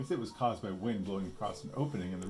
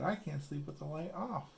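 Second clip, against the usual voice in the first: a hint of a small girl, higher and soft; lightly voiced little girl; 7 semitones higher, 4 dB quieter than usual. A narrator's reading. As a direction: insist like a scared little sister. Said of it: "And she said".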